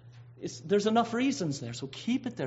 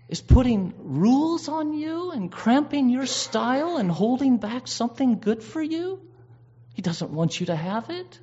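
higher, louder, sly serpent voice; the devil whispering doubt to Eve, sly and coaxing; the tempting serpent; 6 semitones higher, 5 dB louder than usual. Acted. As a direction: coax Eve like a smooth tempter. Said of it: "And that voice said".

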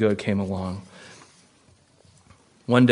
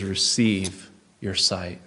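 slow gentle command; 4 semitones lower, 3 dB quieter, slower than usual. Acted. Jesus speaks slowly.

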